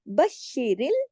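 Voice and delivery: बशिरिल with श pressed and held double; firm doubled SH. Shown as a demonstration. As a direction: join the श firmly in बशिरिल. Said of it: "सख्ती से जोड़ना है". (बशिरिल, शीन सख्ती से)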